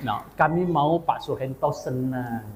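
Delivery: ordinary talk, nothing marked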